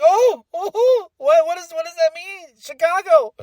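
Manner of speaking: high-pitched, flustered excitement; excited gasping. Retold or imitated. Fan reacting to a tease, high-pitched excitement. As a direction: squeal the questions in rising excitement.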